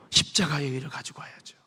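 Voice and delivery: unvoiced hushed whisper. Real, not acted. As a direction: drop to a secret whisper. Whispered aside in the sermon. (속삭이듯 조용히)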